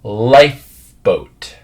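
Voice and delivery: slow, careful "Lifeboat" to copy; slow model word. Shown as a demonstration. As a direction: stretch "Lifeboat" out slowly, pressing lip to teeth for the f. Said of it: "the next one".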